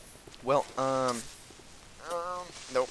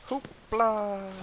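fake Hebrew-ish exclamation; 9 semitones higher, 4 dB louder than usual. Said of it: "trying to say Hebrew".

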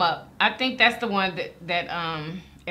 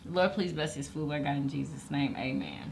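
saying grace; 7 semitones lower, 9 dB quieter than usual. Real, not acted. Grace before the meal.

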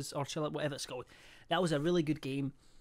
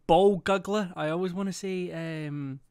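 ordinary talk

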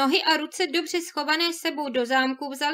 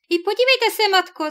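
louder, higher delighted exclamation; excited call; 6 semitones higher, 8 dB louder than usual. A narrator's reading. Greedy sister to her mother, spotting the golden wheel, excited call. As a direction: call out to mother, thrilled by the treasure.

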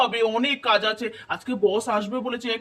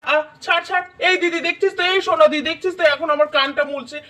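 raised, higher-pitched playful complaint; yelping protest; 5 semitones higher, 4 dB louder than usual. Acted. Brother appeals to sister while ear is twisted, yelping protest.

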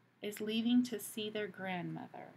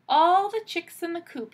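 higher, loud grandmotherly call; wolf calling loudly; 8 semitones higher, 11 dB louder than usual. Acted. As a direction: call the chicks to bed. Wolf calls children to bed.

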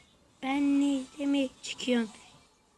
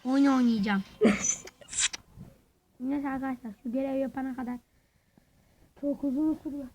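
usual speaking voice

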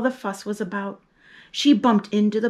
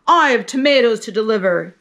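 a louder truck-driver shout; 6 dB louder, faster than usual. Acted. Truck driver shouting back in traffic, loud. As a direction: call it out louder, same pitch. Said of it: "he shouted".

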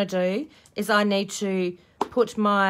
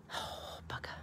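whispering; 17 dB quieter than usual. Real, not acted. Whispered curse.

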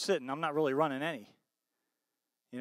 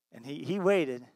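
normal talking voice

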